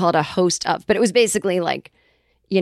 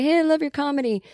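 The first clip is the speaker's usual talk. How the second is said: higher imitation of a famous politician; Bill Clinton; 7 semitones higher than usual. Politician phoning to praise his comedy, mock impression. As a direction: put on a caller's voice, pitched up. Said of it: "if Bill called me up".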